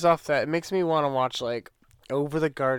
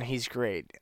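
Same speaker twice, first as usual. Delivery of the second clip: normal talking voice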